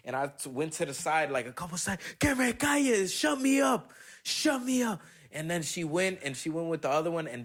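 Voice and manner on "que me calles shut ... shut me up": much higher, loud shouting dare; Hulk rage shout. Retold or imitated. Shouting at the woman and her friend, raging. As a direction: explode like the Green Hulk. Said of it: "I turned into the Green Hulk".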